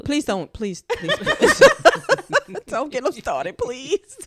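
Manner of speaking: high, laughing please over and over. Real, not acted. Pleading with the men not to start, laughing. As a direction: beg them to stop through real laughter.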